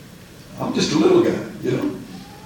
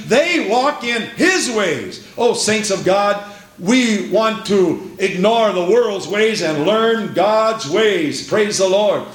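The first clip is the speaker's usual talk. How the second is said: declamatory sermon voice, key phrases drawn out; emphatic, measured scripture preaching; 6 semitones higher than usual. Real, not acted. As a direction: proclaim it to the whole congregation.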